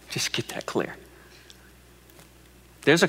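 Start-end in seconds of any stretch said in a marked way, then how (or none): 0.1-0.9 hushed aside. Real, not acted. Intimate aside to congregation.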